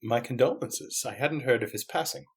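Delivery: soft, hushed condolence; quiet, courteous professor; sympathetic gentleman detective. Acted. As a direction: offer sympathy gently, unhurried at first. Plays Layton in script.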